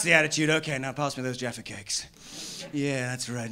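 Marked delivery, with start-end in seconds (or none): none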